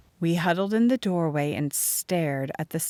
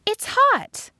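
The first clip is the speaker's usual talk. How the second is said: high, loud sharp warning; 7 dB louder than usual. A narrator's reading. Narrator warning Molly off the hot tin, alarmed. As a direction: stop her before she touches it. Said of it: "I warned her".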